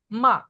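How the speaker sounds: short high-falling মা; fourth tone. Shown as a demonstration. Teacher reading the four tones in order, falling fourth tone. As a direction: drop মা sharply from high. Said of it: "চারটা tone বলছি".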